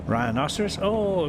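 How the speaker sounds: plain reading voice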